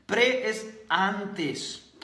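"Pre es antes" spoken very slowly; slow emphatic definition. Shown as a demonstration. Coach defining "pre" to his audience, very slow. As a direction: spell out "Pre es antes" word by word. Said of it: "¿Qué significa eso?".

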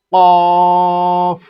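কফ with a very long, loud held vowel; loud drawn-out কফ; elongated vowel. Shown as a demonstration. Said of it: "চার আলিফ পরিমাণ টানতে হবে".

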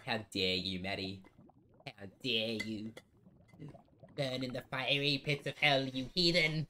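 damn her like a pretend fire-and-brimstone preacher; lightly voiced theatrical villain; mock dramatic curse. Mock dramatic damnation of Maddie.

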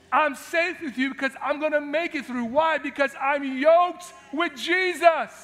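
loud, high, fervent; preaching. Real, not acted. Pastor building to the sermon's climax, loud and fervent. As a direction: drive it home with fire.

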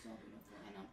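tender low whisper. Real, not acted. [name] whispering praise back to crying mother. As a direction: breathe it to her, unvoiced.

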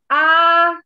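a high "A", loud and high; demonstrating the high voice. Shown as a demonstration. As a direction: reach for the top of the range. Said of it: "Misalnya gini".

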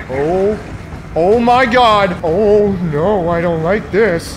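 high, loud, drawn-out oh my God; scared. Real, not acted. Gamer chased in the game, scared cries. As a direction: panic as it closes in.

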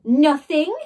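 loud, disbelieving bawl. A narrator's reading. Jemima bawling at Ned through the door, loud. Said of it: "bawled Jemima".

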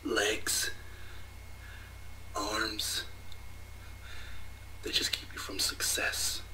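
villain whisper. Acted. Villain taunt, anime parody.

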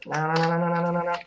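ordinary delivery

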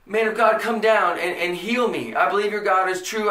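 ordinary delivery

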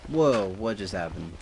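ordinary talk

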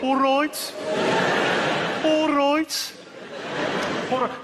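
high, loud Brummie greeting; stretched Brummie vowels; Birmingham accent. Shown as a demonstration. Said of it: "do the Birmingham accent".

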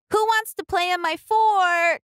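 Ruby calling her brothers to play. Acted. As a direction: call the boys over. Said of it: "she called".

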